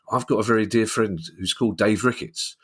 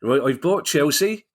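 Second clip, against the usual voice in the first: put-on Dave Ricketts in a Gloucester accent; 8 semitones higher than usual. Mocking friend Dave buying Chelsea, terrible Gloucester accent. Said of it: "I know it's a terrible accent".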